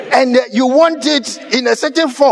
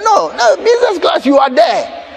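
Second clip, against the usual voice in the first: hint of a fussing attendant; attendant correcting; 6 semitones higher than usual. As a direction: call him back urgently. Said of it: "somebody said".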